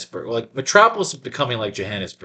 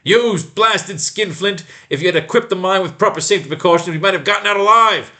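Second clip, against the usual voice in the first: angry accusation; 7 semitones higher, 4 dB louder than usual. Acted. Angry accusation against the mine owner.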